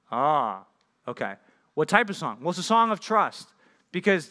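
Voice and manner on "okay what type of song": normal talking voice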